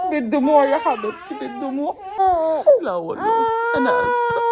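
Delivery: near sobbing as she thinks of her daughter. Real, not acted. A mother tells viewers, tearful. Tender crying over her baby.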